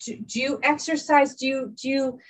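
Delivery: usual speaking voice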